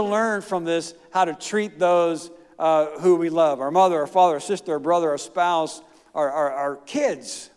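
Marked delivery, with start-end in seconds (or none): none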